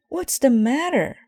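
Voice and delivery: high, loud alarmed question; worried question. Acted. Brother alarmed at Mama crying, high and loud. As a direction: ask in sudden alarm. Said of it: "asked Brother".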